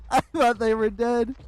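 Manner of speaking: high, loud laughing relief. Real, not acted.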